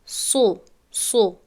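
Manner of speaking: سو said thick with a full ص; heavy emphatic سو; full-mouthed سو; heavy sad. Shown as a demonstration. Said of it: "تکرار کنید در نزد خود".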